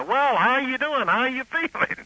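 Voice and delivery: high, mock-cheery host greeting; radio host greeting. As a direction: ham up the jolly announcer. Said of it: "That was the gimmick".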